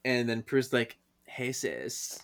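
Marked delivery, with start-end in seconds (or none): 1.2-2.2 very soft, cool hello; acted understated menace; menacing greeting. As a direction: drop the voice for a sinister greeting. Possessed Prue's greeting.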